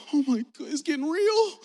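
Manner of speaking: high, loud comic dread; hammed-up frightened exclamation; retold alarm. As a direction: raise the pitch in mounting mock panic. Acts out his childhood alarm.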